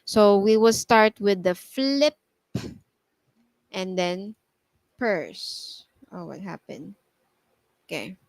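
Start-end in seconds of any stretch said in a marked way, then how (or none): 0.1-5.8 "flip" then "purse" split, double P stressed; emphasize the double P. Shown as a demonstration. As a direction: lean hard on the P in "flip" and "purse". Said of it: "we have to emphasize them".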